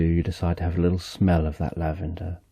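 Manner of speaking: ordinary delivery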